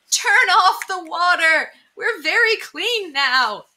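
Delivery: high, loud, drawn-out call; crying out. A narrator's reading. Townspeople call to the mouse flooding the town, crying out slowly. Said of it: "The people of the town cried".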